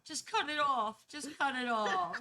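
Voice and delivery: mock dramatic yell. Stuck arm, begging Courtney, hammed-up yell. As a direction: yell it like a melodrama. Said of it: "I yell".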